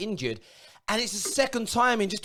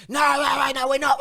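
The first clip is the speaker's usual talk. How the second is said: shouted mock denial of a United fan, higher; mock fan shout, higher and louder; 5 semitones higher, 3 dB louder than usual. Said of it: "why are United looking at selling him".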